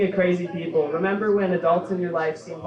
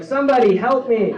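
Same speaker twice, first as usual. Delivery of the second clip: higher, louder cry for help; desperate shout; 7 semitones higher, 7 dB louder than usual. Retold or imitated. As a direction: yell up from the cliff edge. Man hanging from cliff calls out.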